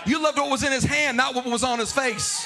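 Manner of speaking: loud, half-sung preacher's questions over the organ; full-force preaching. Acted. Pastor challenging the church to serve God, loud and sung. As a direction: press each question harder on the crowd.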